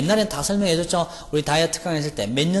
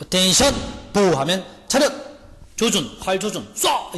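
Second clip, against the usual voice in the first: mock military drill calls; drill commands; 5 semitones higher than usual. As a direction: bark commands at the archers. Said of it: "이렇게 되는 말이야".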